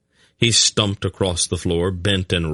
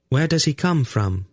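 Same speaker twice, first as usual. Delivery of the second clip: high, curious question; lightly voiced inquisitive woman; 9 semitones higher than usual. A narrator's reading. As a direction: ask with light, high-pitched interest. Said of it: "Lizelle asked curiously".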